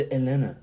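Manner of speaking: Atlanta said as "Ellenna", both t's dropped; local Atlanta pronunciation. Shown as a demonstration. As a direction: swallow the t's in Atlanta, loud. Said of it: "je n'ai pas dit Atlanta, je dis".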